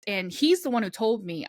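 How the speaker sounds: ordinary talk, nothing marked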